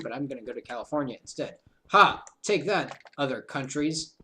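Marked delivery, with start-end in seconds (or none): 1.9-4.1 playful taunting boast; mock triumph. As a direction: gloat at the whole world. Mock triumph over other countries.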